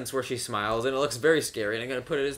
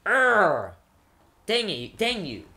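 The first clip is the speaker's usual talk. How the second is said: higher, hammed-up frustrated outburst; mock exasperation; 5 semitones higher, 6 dB louder, slower than usual. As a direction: imitate a melodramatic wife cursing her husband. Mimicking star cursing her cheating husband.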